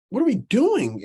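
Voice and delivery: replaying his outrage at the flicked food; retold livid outburst. To teammates after the food hit him, a mock angry demand. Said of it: "I'm livid. I'm heated".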